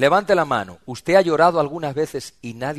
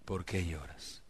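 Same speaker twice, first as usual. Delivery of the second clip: much softer and lower, tender; gentle soft question; 7 semitones lower, 16 dB quieter, slower than usual. Acted. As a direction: ask it quietly, with care. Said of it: "le digo".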